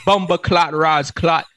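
badly faked Jamaican rant, loud then easing off; horrible Jamaican accent. Jamaican cursing out a Yankee, bad mock Jamaican accent. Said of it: "that is a horrible Jamaican accent".

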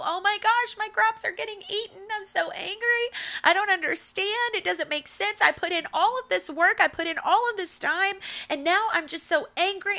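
high, complaining mock voice pitched well up; mock whiny farmer. Preacher mocking a farmer who blames the animals, high whine.

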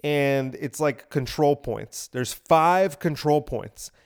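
usual speaking voice

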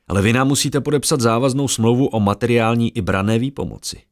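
high, loud fairground barker pitching to a crowd; brash huckster calling out bets; carnival barker. Acted. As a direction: drum up the crowd like a showman selling tickets. Errol pitches contract.

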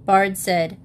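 plain reading voice

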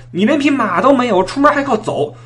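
high mocking jeer; mocking gossip voice. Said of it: "爱爱说了".

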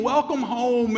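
joyful family shouting a welcome; screaming. Acted. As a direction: call it out like a happy crowd. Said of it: "they're all there screaming".

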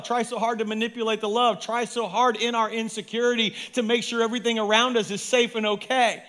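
ordinary talk, nothing marked

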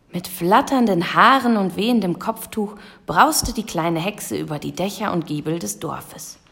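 plain reading voice

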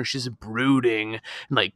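usual speaking voice

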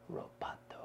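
Roboto whispered with a Spanish accent; a breathy, Spanish-accented Roboto. Shown as a demonstration.

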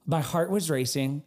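ordinary talk

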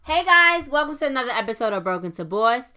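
high, loud and fast hello; excited greeting. Real, not acted. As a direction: burst in with big energy. Podcast intro greeting.

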